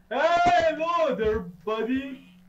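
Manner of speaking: loud, high sarcastic hey to the scare; mock greeting. Real, not acted. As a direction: hail the creature like a pal. Greets monster again sarcastically.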